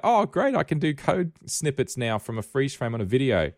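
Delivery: high, loud sarcastic gushing; mock enthusiasm. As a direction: gush like a clueless fan, tongue in cheek. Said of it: "how many average people on the street are like".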